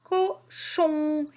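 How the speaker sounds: "cochon" split into high "co" and long "chon"; teacher separating the syllables of "cochon" for children. Shown as a demonstration. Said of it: "la syllabe que j'entends".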